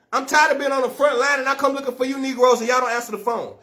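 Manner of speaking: ordinary delivery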